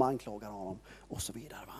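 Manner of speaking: hushed whispered aside. A narrator's reading. Preacher whispers an aside to the congregation. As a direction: let it drop to a whisper.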